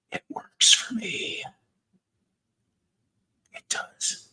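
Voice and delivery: breathy whispered aside; whispering. Acted. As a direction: whisper it like a secret. Joking aside about his drama.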